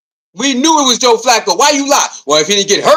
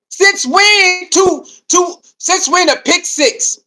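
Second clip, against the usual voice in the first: incredulous drawl; 6 semitones higher, much slower than usual. Real, not acted. Incredulous complaint.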